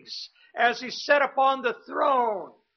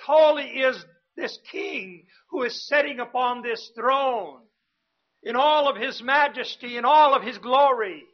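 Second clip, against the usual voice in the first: louder, ringing proclamation of holy; proclaiming the angels' cry; 4 dB louder than usual. Acted. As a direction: call it out like a herald before the throne. Angels' cry quoted in sermon.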